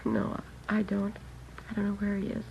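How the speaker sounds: shaken and hushed. Acted. Sister answering detectives after the murder news, hushed and stunned. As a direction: barely get the words out.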